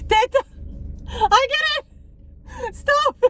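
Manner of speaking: very high-pitched playful objection; mock squealed protest. Granddaughter begging grandmother to stop, high mock squeal.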